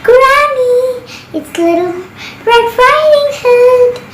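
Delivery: high, sweet put-on girl's voice; fox's beautiful voice. Fox at Granny's door pretending to be Red, high sweet voice. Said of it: "a beautiful voice coming from the door".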